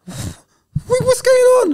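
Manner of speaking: high, loud alarmed question; startled cry of a worried mentor. Retold or imitated. Alarmed voice retold.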